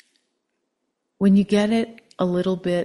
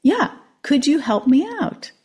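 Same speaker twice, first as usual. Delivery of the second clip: higher, hopeful frog voice; eager frog; 8 semitones higher, 5 dB louder than usual. A narrator's reading. Frog asking the old man again, higher. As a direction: plead up at him as the frog. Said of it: "And the frog says".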